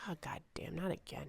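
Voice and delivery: wife whispering her dread as her husband gets frisky; weary whisper. Pretend. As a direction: mutter it under your breath, fed up. Julia dreading drunk husband.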